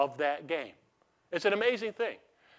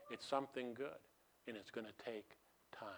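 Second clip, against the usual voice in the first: hushed whisper, barely voiced; whispered point; 4 semitones lower, 15 dB quieter than usual. Preacher to congregation, whispering that change takes time. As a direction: lean in and whisper it to them.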